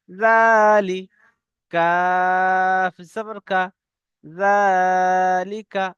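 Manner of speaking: syllables chanted slowly, জা held long; slow spelled recitation. Shown as a demonstration. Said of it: "বলেন".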